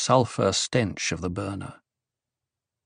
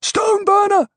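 screamed; 5 dB louder than usual. A narrator's reading. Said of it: "The cry was all around him".

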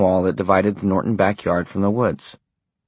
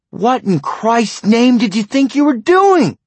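furious roar, much higher and louder; 7 dB louder than usual. A narrator's reading. Husband roaring at his wife over her story. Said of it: "he roared".

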